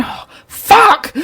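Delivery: put-on high, laughing toddler exclamation; toddler swearing. Mother imitating her toddler daughter swearing, high and laughing. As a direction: blurt it like a delighted small child. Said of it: "like this".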